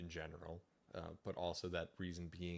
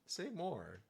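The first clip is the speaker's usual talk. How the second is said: eager "say more"; 10 semitones higher than usual. Retold or imitated. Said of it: "I'd be like".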